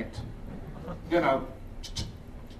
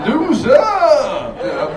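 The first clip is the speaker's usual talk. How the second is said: mock taunt; 5 semitones higher, 9 dB louder, much slower than usual. Taunting allergic friend.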